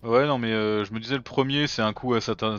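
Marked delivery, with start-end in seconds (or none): none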